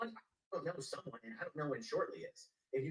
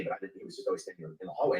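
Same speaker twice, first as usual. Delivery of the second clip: normal talking voice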